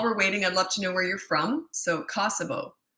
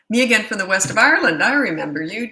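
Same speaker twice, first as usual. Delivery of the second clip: playful Irish lilt, louder; Irish accent; 3 semitones higher, 7 dB louder than usual. Pretend. Greeting a returning Irish viewer, in an Irish accent. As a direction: put on a lively Irish accent. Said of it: "I don't know what Norwegian people sound like".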